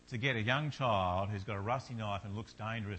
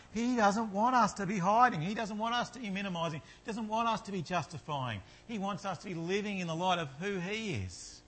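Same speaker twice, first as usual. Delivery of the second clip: rising, louder, higher sermon build; impassioned preaching; 8 semitones higher, 4 dB louder than usual. Acted. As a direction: preach it with mounting fervour.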